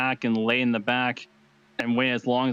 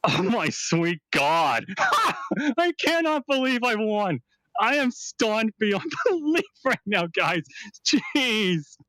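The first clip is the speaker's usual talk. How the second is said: real high-pitched, laughing disbelief; slow, drawn-out winner's squeal, laughing in shock; stunned excitement; 8 semitones higher, much slower than usual. Real, not acted. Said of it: "love to hear the excitement in your voice".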